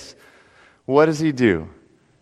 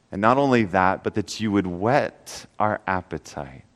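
soft, low prayer voice, slowing near the end; quiet prayer; 9 semitones lower, 5 dB quieter than usual. Acted. Pastor prays softly before the congregation, quiet prayer voice. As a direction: pray quietly and earnestly to God.

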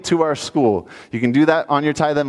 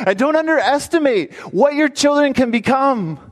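fervent preaching; 7 semitones higher than usual. Real, not acted. Preacher exhorting the congregation.

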